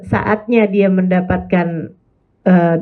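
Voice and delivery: ordinary delivery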